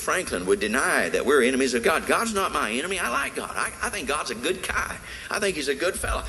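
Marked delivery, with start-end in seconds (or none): 2.1-6.2 high, soft, airy mock voice; sing-song caricature of a complacent believer; mock naive voice. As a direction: mimic a smug churchgoer dismissively. Mimics people who deny it.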